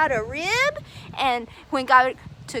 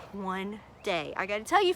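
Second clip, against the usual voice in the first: ordinary delivery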